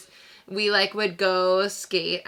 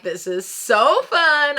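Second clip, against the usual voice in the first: loud, raised, retold glee; higher, louder thrill of skating; excited delight; 7 semitones higher, 7 dB louder than usual. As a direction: gush with reenacted joy. Voices own delight while skating.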